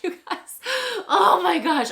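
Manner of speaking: giggling embarrassed outburst; real laughter. Real, not acted. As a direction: laugh helplessly while talking.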